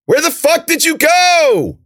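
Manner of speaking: furious high, loud yell; drawn-out exasperated shout; crying out. A narrator's reading. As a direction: holler it slowly into the storm. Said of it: "he cried".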